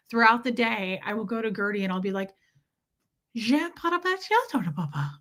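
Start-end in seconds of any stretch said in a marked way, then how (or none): 3.3-5.1 put-on high-pitched nonsense baby talk, falling away at the end; French-sounding pet babble. Shown as a demonstration. Owner babbling to Gertie throughout the day, mock-French baby talk. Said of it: "It almost sounds like French when I do it".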